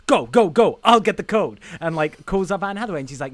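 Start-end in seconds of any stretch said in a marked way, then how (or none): 0.1-1.6 loud, hurried urgency; urgent heist command. Retold or imitated. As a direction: hustle the team, alarm beeping. Acted heist panic.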